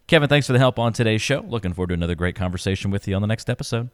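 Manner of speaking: fast, bright show wrap-up; quick host sign-off. Acted. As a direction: rattle off the outro.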